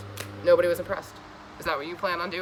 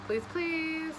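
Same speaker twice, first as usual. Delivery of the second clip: high pleading; 9 semitones higher, 5 dB quieter than usual. Real, not acted. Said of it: "begging the viewer".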